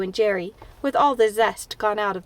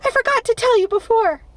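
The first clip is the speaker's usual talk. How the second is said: high, loud panicked shriek; 12 semitones higher, 5 dB louder than usual. A narrator's reading. Anne shrieking a warning to Marilla at dinner, high and loud. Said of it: "shrieked out".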